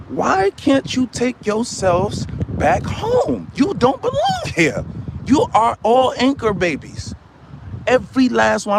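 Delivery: slow, high-pitched shout, voice cracking upward; ranting shout. Acted. Ranting man yells at listeners to go home.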